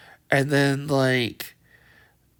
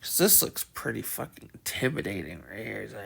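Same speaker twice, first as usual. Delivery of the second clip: usual speaking voice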